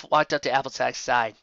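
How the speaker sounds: ordinary delivery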